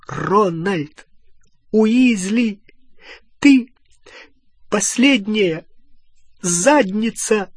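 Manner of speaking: high, punched words with gaps between; word-by-word fury. A narrator's reading. Hermione hitting Ron with every word, punctuated fury. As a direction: land each word like a blow. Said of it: "Каждое слово сопровождалось ударом".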